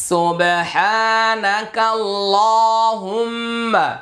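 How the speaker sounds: slow chanted সুবহানাকাল্লাহুম্মা; tajweed recitation. Shown as a demonstration. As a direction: stretch it out like prayer recitation. Recitation of the dua. (ধীরে টেনে দোয়া পাঠ)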